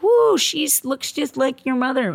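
high, slow sarcastic whoop; mock the fake celebration; high, drawn-out sarcastic cheer. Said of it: "we should be all like".

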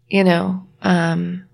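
ordinary delivery

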